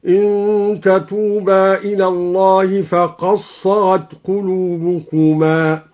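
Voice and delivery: فقد صغت with dal merged into sad, تظاهرا with doubled za; slow recitation with assimilation. Shown as a demonstration. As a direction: chant slowly, فقصّغت and تظّاهرا. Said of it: "بإدغام الدال في الصاد ... هكذا". (ترتيل بطيء بإدغام الدال في الصاد وتشديد الظاء)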